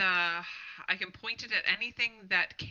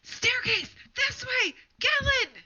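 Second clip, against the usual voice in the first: high, full-volume shout; 9 dB louder, much slower than usual. Acted. Player shouts for Gellin to take the staircase, high urgent yell. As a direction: yell it out as loud as you possibly can. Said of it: "I'm gonna shout".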